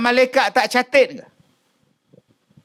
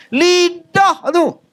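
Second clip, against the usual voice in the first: slow, higher mocking voice; mengejek orang mengumpat; 9 semitones higher, much slower than usual. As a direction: drag it out like a gossip pointing. Ustaz mimics gossiping tongue. (suara mengejek perlahan)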